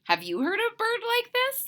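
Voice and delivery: ordinary delivery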